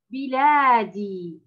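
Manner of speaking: drawn-out model word بلادي; slow model reading. Shown as a demonstration. Teacher models the word for Ola, slow. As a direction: read بلادي slowly, syllable by syllable. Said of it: "أول كلمة كلمة".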